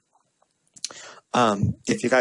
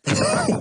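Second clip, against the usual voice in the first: high laugh. Real, not acted. Laughs mid-quote.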